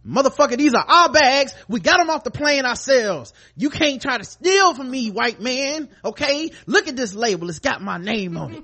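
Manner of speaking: high, loud and outraged; indignant yell. Retold or imitated. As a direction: yell it like someone's stealing your bags. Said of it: "And I'm like".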